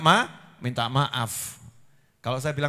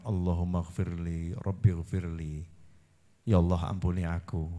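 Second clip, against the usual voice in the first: low prayer; 11 semitones lower, 6 dB quieter than usual. Pretend. Prayer quoted.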